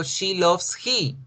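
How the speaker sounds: slow, careful "she loves he" with a falling end on "he"; slow teacher demo of the wrong form; slow wrong example. Shown as a demonstration. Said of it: "pero yo no digo".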